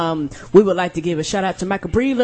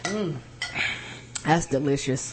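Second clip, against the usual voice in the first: low, soft savoring murmur; 5 semitones lower, 8 dB quieter, slower than usual. Real, not acted. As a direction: savor it quietly. Tasting the drink.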